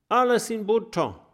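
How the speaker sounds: high, cheerful all-clear; relieved and upbeat; cheerful call. A narrator's reading. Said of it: "ruft er".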